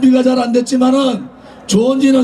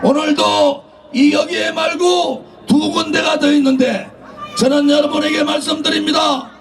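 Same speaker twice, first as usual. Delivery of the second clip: normal talking voice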